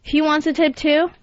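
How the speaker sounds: ordinary talk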